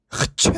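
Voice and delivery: loud sudden sneeze; startling sneeze. Acted. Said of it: "어이, 깜짝이야".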